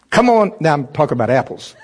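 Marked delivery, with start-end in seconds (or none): 0.1-0.5 straining urge. Pretend. Urging the fruit out.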